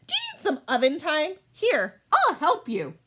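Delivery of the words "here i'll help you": normal talking voice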